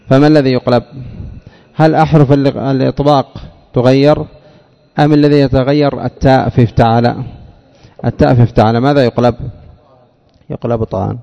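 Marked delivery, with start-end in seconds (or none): none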